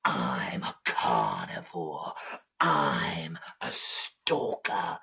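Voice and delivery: very low, breathy predator voice; deep, stalking big cat; menacing jaguar. Acted. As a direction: growl it out low and slow. Jaguar boasts to Little Crab.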